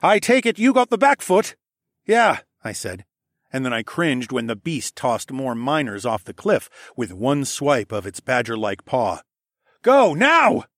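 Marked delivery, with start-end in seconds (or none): 0.0-2.4 high, loud urgent question; shouted check. A narrator's reading. Shouted planning amid battle. 9.8-10.7 high, loud shouted order; urgent command. A narrator's reading. Battle command to attack.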